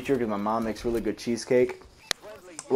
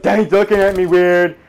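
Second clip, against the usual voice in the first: loud, high, slow imitation of a schoolmate; mock complaining kid; 6 semitones higher, 7 dB louder than usual. Imitating a schoolmate bothered by being looked at, loud high mock voice. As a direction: put on a high, drawn-out voice and call out.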